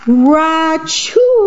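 high, hammed-up ra-choo sneeze; mock sneeze. Reading the bear's sneeze to kids, hammed-up sneeze. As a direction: burst out a big fake sneeze.

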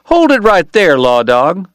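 a drover blustering at the lawman. A narrator's reading. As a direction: puff up like a bully. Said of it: "trying for bluster".

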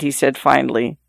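ordinary delivery, nothing marked

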